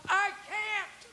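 high, very faint call; faint acted cry from the crowd; distant refusal. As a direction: throw it out high and tiny, as from far away. Heckler's repeated refusal, acted.

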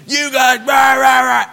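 imitating a furious man yelling rah rah; retold yell. Furious customer yelling at the new employee, mock shout. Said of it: "yelling at me".

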